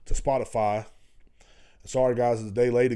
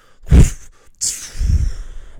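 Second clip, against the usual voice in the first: mocking laugh; 13 dB louder than usual. Mocking people who flee.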